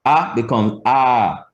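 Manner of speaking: A stretched out long; long vowel. Shown as a demonstration. Teacher showing Jamaican vowel length, slow long a. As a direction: draw the a out in time. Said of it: "just stretched out in time".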